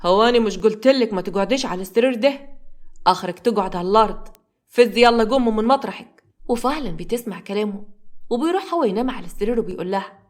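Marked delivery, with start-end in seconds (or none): none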